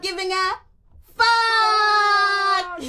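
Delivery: builds up, then yells the last word very high and loud; shouted swear word. Real, not acted. Reading the book title to her live viewers, building to a shout. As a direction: blurt the final word as a full shout.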